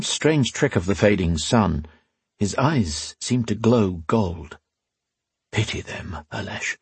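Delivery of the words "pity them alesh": hushed whisper. Acted. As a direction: breathe it softly, almost unheard. Priest whispers.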